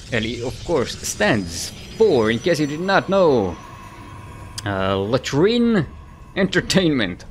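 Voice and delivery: drawn-out, punchy ring-announcer intro; big esports announcer voice, slow on the names; match announcer. Acted. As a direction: introduce the players like a fight announcer, stretching the names.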